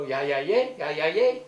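loud pretend cheer in Dutch; eager Dutch reply. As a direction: answer loudly, playing the learner's part. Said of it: "you go".

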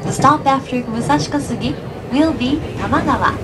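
ordinary talk

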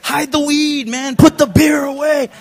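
hurry, hide everything; panicked order. Retold or imitated. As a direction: bark it out in a panic.

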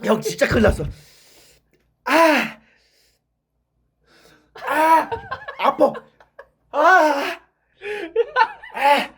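loud high pained yells; desperate, tongue on fire; mouth burning from spicy food. Real, not acted. Screaming from spicy pain.

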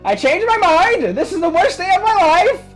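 high, loud exasperated shout; outraged yell. Acted. Papyrus groaning at his brother's joke, loud outraged yell. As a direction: scream your outrage at the pun.

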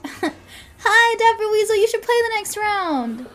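high, bright-pitched shout-out to a viewer; excited greeting. Real, not acted. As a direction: call out a happy hello. Greets a viewer joining the stream.

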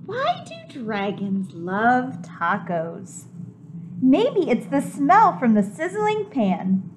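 plain reading voice

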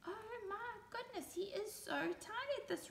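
high, soft amazement; soft high fond surprise; hushed wonder. Acted. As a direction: hush so not to wake him. Wonder at sleepy Roly.

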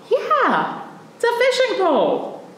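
ordinary talk, nothing marked

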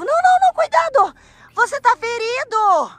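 high, loud burst of alarm; alarmed warning. Pretend. Girl warns her wounded friend not to move, alarmed. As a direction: cry out urgently to stop someone.

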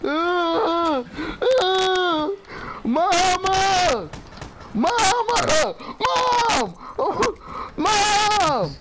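high wailing cries, half laughing; whiny, high-pitched sobbing shout; panicked calling for mama. Real, not acted. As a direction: scream for your mother in a game panic.

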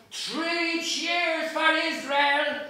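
slow, loud, high bawl. Acted. Bloom bawling to the crowd, slow loud cry. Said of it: "he bawls out of him".

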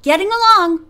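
high call on the way off. A narrator's reading. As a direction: call back while leaving. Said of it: "called Robin".

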